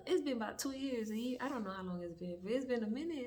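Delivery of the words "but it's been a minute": normal talking voice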